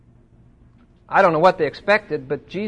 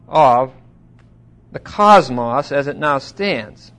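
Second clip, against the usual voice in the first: usual speaking voice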